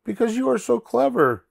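high-pitched little pig character; squeaky-high storybook pig; Pig's voice. Acted.

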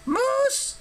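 very high squeaky creature, relishing it; savoring the word. A narrator's reading. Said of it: "savoring the word".

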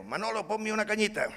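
bar customer ordering. Retold or imitated.

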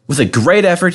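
ordinary delivery, nothing marked

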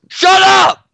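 sudden high yell; shouting. Real, not acted. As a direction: snap a loud shut up. Yelling at a friend.

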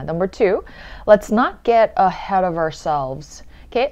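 ordinary talk, nothing marked

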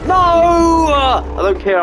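high, slow, loud wail of defiance; defiant drawn-out cry. Real, not acted. Gamer losing the fight, wailing. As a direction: stretch it out, howling at the game.

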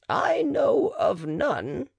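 hammed-up stern midwife; the matron. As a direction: draw it out firmly, shutting him down. Matron refusing the marquis.

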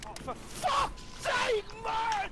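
high, drawn-out frustrated yell; exasperated shout. Real, not acted. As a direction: wail it in total exasperation. Exasperated outburst.

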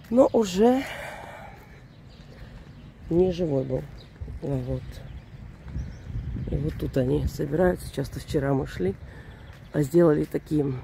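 ordinary talk, nothing marked

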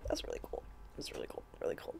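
breathy whispered awe. Real, not acted. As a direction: whisper it to yourself. Whispered repeated comment.

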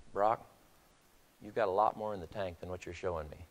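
low, soft, earnest coach; hushed encouragement. Retold or imitated. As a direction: lean in close and speak softly to a spent player. Coach leaning down to exhausted player.

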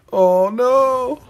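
high, wailed dismay; dismayed cry. Real, not acted. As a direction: wail it in alarm. Dismay at friend being hit.

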